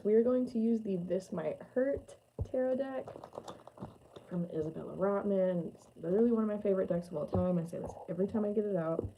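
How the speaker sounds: ordinary talk, nothing marked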